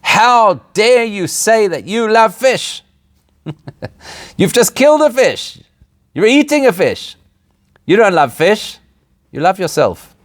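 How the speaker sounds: high, slow, scolding outrage; indignant outrage. Retold or imitated. Whale activist confronts diner over salmon, mock indignant high scolding. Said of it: "marches over indignantly ... and says".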